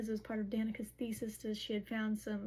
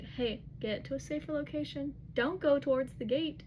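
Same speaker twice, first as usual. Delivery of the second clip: raised, pressing warning, imitated; urgent instructions; 4 semitones higher, 4 dB louder than usual. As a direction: act out urgent orders, higher and getting louder. Retold urgent warning by phone.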